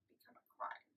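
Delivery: barely voiced, trailing into a whisper; anguished whisper. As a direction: let the question drop to a faint whisper. Anguished question after the slap.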